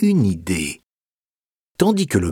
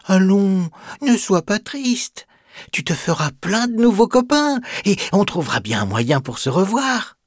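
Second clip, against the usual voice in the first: higher, gentle ghost voice cheering a friend; comforting ghost; 9 semitones higher than usual. A narrator's reading. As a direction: coax the boy out of his sadness with a chuckle. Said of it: "pour le réconforter".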